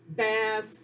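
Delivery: BASKET drawn out slowly on a level pitch; blended and held. Shown as a demonstration. Teacher models blending sounds into a word, blended and held. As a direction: stretch both vowels of BASKET into one held blend. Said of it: "Blended hold".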